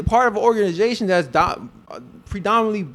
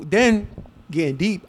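normal talking voice

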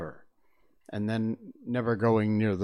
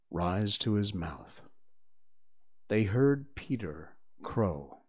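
ordinary delivery, nothing marked

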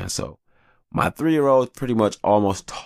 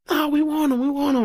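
mock little girls pleading. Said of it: "daughters answering".

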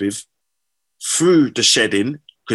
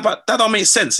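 pitched way up in disbelief; incredulous; 11 semitones higher than usual. Retold or imitated. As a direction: scoff at it, high and unbelieving. Retelling his own disbelief.

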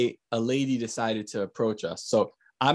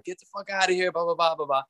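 mild mock tirade, pitched up; retold cursing; 3 semitones higher than usual. Retelling cursing at the lady, mock angry rant. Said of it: "I'm cursing at her".

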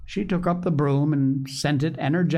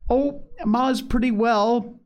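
higher, mildly raised call to a deaf listener; shouting into an ear; 9 semitones higher, slower than usual. A narrator's reading. Polly to deaf Grandma, a mild pitched-up shout. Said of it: "shouted Polly into the old lady's ear".

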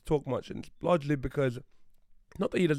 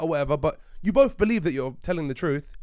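ordinary talk

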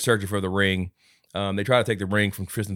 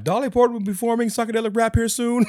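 higher, lightly hammed-up announcer; mock event host; 14 semitones higher, 4 dB louder than usual. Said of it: "he's like".

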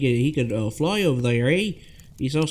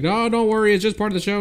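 high, louder breezy brush-off; cheery cop; 8 semitones higher, 3 dB louder, faster than usual. Retold or imitated. Cop brushing off the worried mom, breezy high voice. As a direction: wave her off like a chipper cop. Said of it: "the cop's like".

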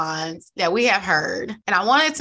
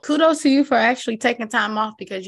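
usual speaking voice

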